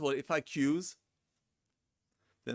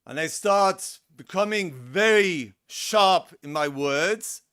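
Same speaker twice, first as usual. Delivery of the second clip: louder and sharper, voice raised; 4 semitones higher, 10 dB louder, slower than usual. Shown as a demonstration. Therapist showing a sharp raised voice to a client, loud and sharp. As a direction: push the voice up and get sharp. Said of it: "if I put my voice up".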